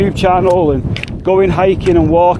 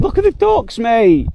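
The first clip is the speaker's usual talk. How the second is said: sudden high squeal of delight at the ducks; light up, much higher, at spotting ducks; 7 semitones higher than usual. Real, not acted. Hiker spots ducks on the tarn, much higher delighted squeal.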